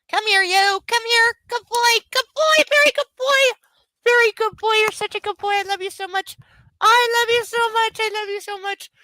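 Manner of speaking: high sing-song coo to a dog. Real, not acted. Owner cooing at her puppy in baby talk. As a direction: gush over the puppy in a high voice.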